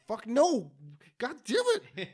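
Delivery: high, loud mock-frustrated yell; frustrated outburst. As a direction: act out an exasperated refusal, pitch jumping up. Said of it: "And he's just frustrated".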